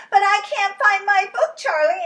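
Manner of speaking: very high and loud complaint; a little girl too loud for the library; loud upset Lola. Acted. Lola complaining loudly to Charlie, high.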